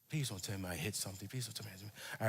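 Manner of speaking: quick, low pleading under the breath; hushed panicked pleading. Retold or imitated. Driver to himself after the bump, hushed fast pleading. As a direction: beg softly and fast, retold dread. Dreading that he hit something.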